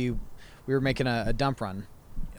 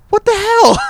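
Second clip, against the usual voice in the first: very high, loud shriek; hammed-up shrieking outburst; mock outraged scream; 17 dB louder than usual. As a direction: imitate a mother's screeching disbelief. Retelling mom's shocked outburst.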